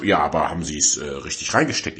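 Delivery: imitated placid tech support; unmoved hotline agent. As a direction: ask it low, by the script. Hotline agent voiced against upset customer.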